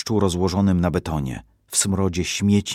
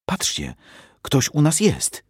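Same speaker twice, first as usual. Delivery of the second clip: put-on higher, slow-drawn child's call of discovery; excited child's cry; 7 semitones higher than usual. Girl spotting someone in their ruined home, excited cry. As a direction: point it out with a little girl's sudden thrill. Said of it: "krzyknęła Basia".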